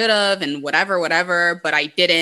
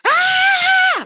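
sudden piercing scream, much higher and louder; friend's scream; 6 dB louder than usual. Retold or imitated. Friend screams at the men crowding their table, piercing scream. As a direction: let out one loud startled scream to scare them. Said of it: "I mean she went".

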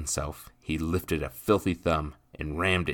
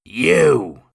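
huge higher furious bellow; 10 semitones higher, 14 dB louder than usual. Acted. Nathan charging William, furious bellow. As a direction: roar it at him. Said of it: "Nathan bellowed".

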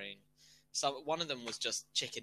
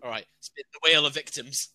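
loud; 3 semitones higher, 13 dB louder than usual. Real, not acted. Loud mock announcement.

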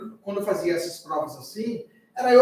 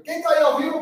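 calling out; 8 semitones higher, 4 dB louder than usual. Real, not acted. Calling out to live chat.

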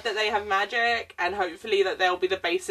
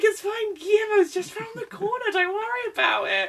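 high, sweet-talking fake reassurance; mock Disney exec; 12 semitones higher than usual. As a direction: lure him in with a high, oily sales voice. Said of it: "a Disney exec, like, just luring him".

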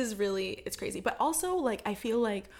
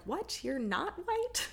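mock surprise; 7 semitones higher, 3 dB quieter, much slower than usual. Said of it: "been like".

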